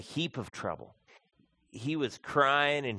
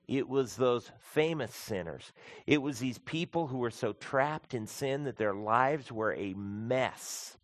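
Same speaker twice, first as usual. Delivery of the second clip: usual speaking voice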